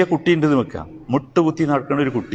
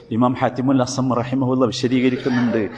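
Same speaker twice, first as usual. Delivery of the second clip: ordinary talk, nothing marked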